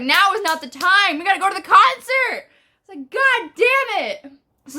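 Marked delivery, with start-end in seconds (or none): 0.0-2.5 high, loud retold protest; loud mock-urgent outburst; frantic protest. Panicked retelling of her protest. 3.1-4.3 high, slow drawn-out retold curse; slow, loud hammed-up curse; exasperated curse.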